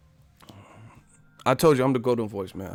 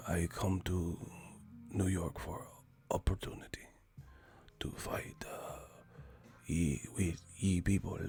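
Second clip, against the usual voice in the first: Cotto whisper; 4 semitones lower, 14 dB quieter than usual. Retold or imitated. Mocking Cotto's quiet interview, faint whisper. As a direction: whisper like the mic is off. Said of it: "Cotto's audio gain on negative fifteen".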